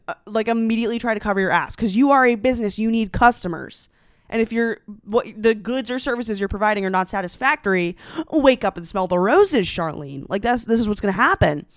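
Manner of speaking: normal talking voice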